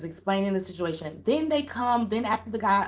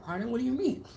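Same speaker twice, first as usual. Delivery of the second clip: softer, higher mock-British question; British accent; 4 semitones higher, 5 dB quieter, faster than usual. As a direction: imitate a politely puzzled Brit. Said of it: "His British accent, his British accent".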